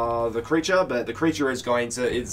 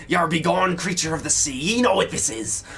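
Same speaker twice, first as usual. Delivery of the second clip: put-on gruff old sailor; 5 semitones higher, 5 dB louder than usual. Theron wards off sea creature.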